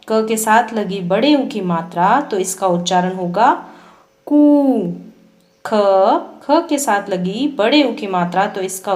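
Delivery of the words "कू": कू with a long oo, pitched higher and louder. Shown as a demonstration. Teacher modelling कू for pupils, long and high. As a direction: stretch the ऊ in कू and lift it. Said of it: "लंबे समय तक और ऊंचे स्वर में पढ़ा".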